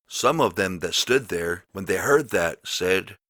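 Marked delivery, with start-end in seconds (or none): none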